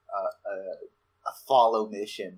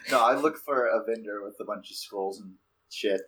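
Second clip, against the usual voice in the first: usual speaking voice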